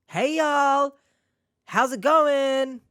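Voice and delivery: high, hammed-up Southern drawl; attempted Southern accent. Showing up the scammers' fake accent, mock Southern accent. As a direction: put on a dubious Southern accent, pitched high. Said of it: "I don't even know what the accent was".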